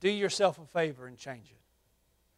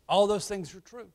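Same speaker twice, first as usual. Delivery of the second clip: ordinary talk, nothing marked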